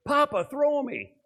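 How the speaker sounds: put-on high, drawn-out child's call to grandpa; excited grandson. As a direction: imitate a little boy begging to be thrown. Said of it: "My grandson".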